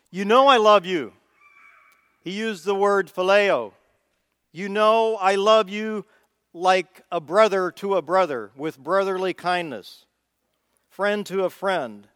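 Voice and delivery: loud, high, fervent declaration. Retold or imitated. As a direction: proclaim it with fervor. Peter's fervent reply voiced.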